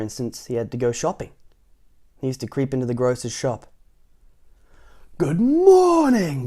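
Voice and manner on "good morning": very high, loud, slow bellow; bellowed greeting. Acted. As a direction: boom it like a loud grocer. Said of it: "bellowed the grocer".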